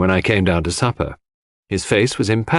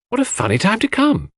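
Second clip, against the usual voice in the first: surprised; 13 semitones higher than usual. Acted. Surprised at late visitor.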